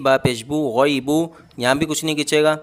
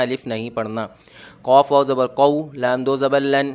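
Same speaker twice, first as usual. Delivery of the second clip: ordinary talk, nothing marked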